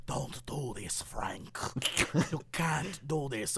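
low, hushed mock Russian voice; Russian-tinged Southern accent. Old boss warns the hero, low muddled Russian-Southern accent. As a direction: imitate a Russian playing a Southern dude, deep and soft. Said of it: "he still has a Russian voice".

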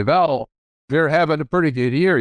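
imitating his Dutch father with a hint of brogue; lightly voiced Dutch dad, W turned to V. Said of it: "in his Dutch brogue".

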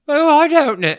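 high, loud hammed-up British; fake British accent. Shown as a demonstration. Guest showing host their fake British accent, high and exaggerated. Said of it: "let me hear your fake British accent".

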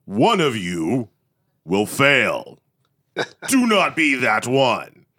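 slow booming announcer over a PA; booming microphone voice. Retold or imitated. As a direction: boom it out slowly like an auditorium speaker. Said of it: "the booming voice over the microphone was straight up like".

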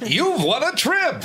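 very high, slow scam-call voice; robocall. Retold or imitated. Panelist mimics a scam robocall, very high and slow. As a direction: sing it out like a fake prize call. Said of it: "It's like".

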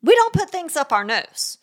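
firm parent closing the discussion. Shown as a demonstration. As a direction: cut off debate with one firm line. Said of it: "is firm".

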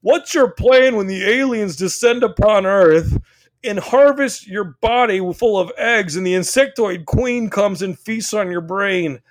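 hammed-up alarmist stepdad; mock paranoid stepdad. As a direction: spin a wild doomsday threat. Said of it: "stepdad would be like".

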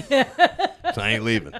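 slow, high, cracking up through it; laughing punchline. Retold or imitated. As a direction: giggle out the punchline. Punchline of his store story.

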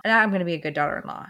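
fast, tumbling repeat of the same line; rushed repeat. Shown as a demonstration. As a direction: rattle it off quickly. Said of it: "repeated faster".

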